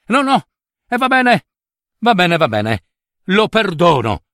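high, urgent giving in; hurried high surrender, begging her to stay; cried out giving in. Acted. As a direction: call her back, pleading and relenting at once. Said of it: "gridò Reuccio".

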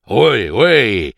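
gruff mock-grumpy grunt. A narrator's reading. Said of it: "grugnì Scrooge". (grugnito burbero)

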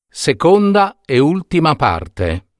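ordinary delivery

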